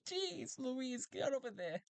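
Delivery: very high squeaky robot yelp; robot exclaiming. Acted. Robot waving Talti in, squeaky high exclamation. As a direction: yelp it like a startled cartoon robot.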